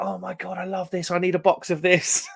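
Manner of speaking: retold gushing. Ukrainian customer to the streamer about the lager, retold gushing. Retells the woman's delighted reaction.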